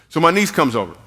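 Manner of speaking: normal talking voice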